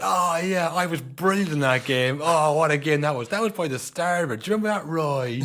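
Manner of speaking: imitating Mika loudly bragging to Roy Keane; loud boast. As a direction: crow about the old game, hamming it up.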